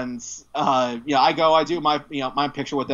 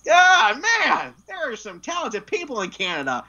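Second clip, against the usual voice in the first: imitating Michael Rooker; 8 semitones higher than usual. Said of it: "He's like".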